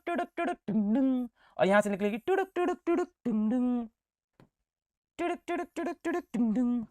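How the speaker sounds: high, soft sing-song tuduk tung noises; bouncing sound effect. Shown as a demonstration. Teacher showing students a ray bouncing, sing-song and soft. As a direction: chant the bounce like a ball pinging.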